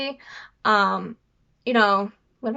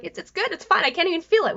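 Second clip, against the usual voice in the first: higher-pitched, mock-reassuring reply; retold reassurance; 7 semitones higher than usual. Daughter answering mom's question, high-pitched retold reassurance. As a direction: act out brushing off mom's question. Voicing own reply to mom.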